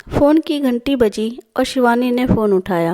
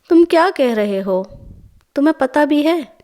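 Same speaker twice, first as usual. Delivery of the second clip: rising, higher disbelief; surprised; 4 semitones higher than usual. A narrator's reading. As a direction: caught off guard, asking in shock. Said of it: "Shivani हैरान होकर बोली". (हैरानी भरा सवाल, आवाज़ ऊपर जाती हुई)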